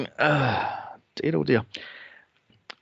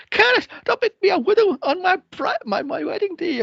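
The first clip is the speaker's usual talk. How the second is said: very high, whiny pleading; mimicking the bride begging on her wedding day; mock hysterical bride. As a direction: wail it like a melodramatic bride. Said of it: "She goes".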